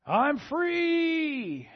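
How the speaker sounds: high, loud, exultant shout of freedom; sixties freedom cry. Retold or imitated. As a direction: throw your arms wide and cry out like a free-spirited sixties rebel. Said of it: "That was the sixties cry".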